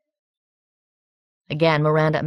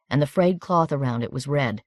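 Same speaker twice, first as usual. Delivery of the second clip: ordinary delivery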